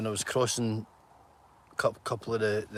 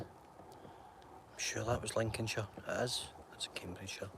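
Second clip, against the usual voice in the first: whispering to himself; 15 dB quieter than usual. Real, not acted. Doubting himself about the county, whispering. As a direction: mutter it under your breath.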